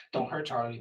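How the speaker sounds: softly pleading; soft plea. Acted. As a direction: beg it quietly. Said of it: "send one more message".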